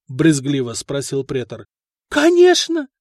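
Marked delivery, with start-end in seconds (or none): 2.1-2.9 high, loud and eager; eager reply. A narrator's reading. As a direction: jump at it eagerly. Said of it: "с готовностью ответила женщина".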